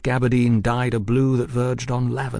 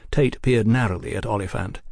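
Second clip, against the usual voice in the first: plain reading voice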